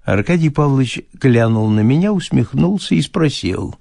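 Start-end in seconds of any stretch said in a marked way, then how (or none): none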